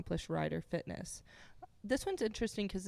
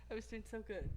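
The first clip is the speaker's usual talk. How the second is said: laughing; 7 dB quieter, much faster than usual. Real, not acted. Cracking up mid-read.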